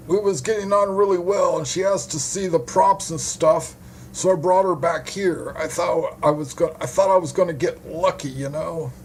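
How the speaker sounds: high-pitched hapless witness, chatting away; duped male witness, chatty. Acted. Witness explains to detective about the flirty woman, high chatty voice.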